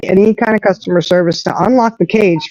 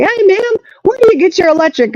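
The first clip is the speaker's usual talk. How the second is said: chirpy store clerk; 12 semitones higher than usual. Retold or imitated. Store worker quoted in story.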